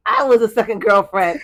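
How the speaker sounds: laughing out loud in disbelief. Real, not acted. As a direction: shout it through a big laugh. Laughing reveal of being second.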